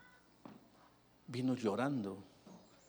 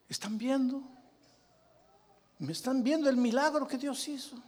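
excited exclaiming; 12 semitones higher, 5 dB louder than usual. Retold or imitated. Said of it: "Entonces yo dije".

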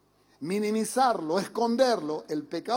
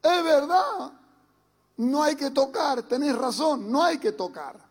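put-on contrite child; 5 semitones higher, 3 dB louder than usual. Child admitting to his father he was right, half laughing. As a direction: sound like a kid sheepishly saying sorry. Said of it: "lo que tiene que hacer el niño es".

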